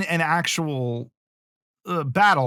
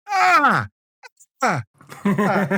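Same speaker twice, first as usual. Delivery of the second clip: mock sword-fight grunts; 4 semitones higher, much slower than usual. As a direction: ham up a fake sword fight with silly grunts. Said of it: "pirates didn't sword fight like".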